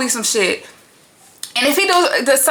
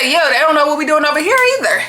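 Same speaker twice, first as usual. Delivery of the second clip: high, fast, louder quoted comeback; quoting Sincere; 6 semitones higher, 3 dB louder, faster than usual. Retold or imitated. Vlogger voicing Sincere's clapback to KC, quick and high. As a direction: fire it back like a quick retort.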